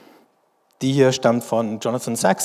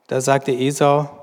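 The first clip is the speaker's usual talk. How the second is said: normal talking voice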